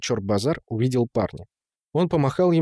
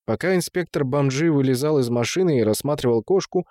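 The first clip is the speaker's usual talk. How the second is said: usual speaking voice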